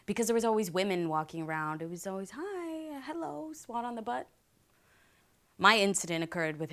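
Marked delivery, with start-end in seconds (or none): 2.3-4.3 high, soft, drawn-out mock greeting; imitated handsy uncle. Uncle greeting the women walking around, in a high mock-flirty voice. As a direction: put on a higher, softer voice for a handsy uncle. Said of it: "my uncle was always known as".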